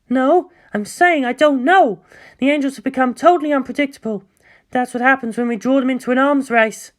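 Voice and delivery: high, clipped-in-pace explanation; a hint of a strained scientist; tense scientist. Scientist voiced higher.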